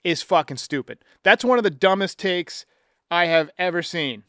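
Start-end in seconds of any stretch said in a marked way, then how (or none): none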